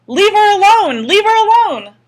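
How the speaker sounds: very high, very loud scream. A narrator's reading. Boy screaming at the mob attacking his aunt.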